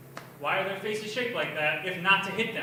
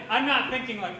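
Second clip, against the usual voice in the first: ordinary delivery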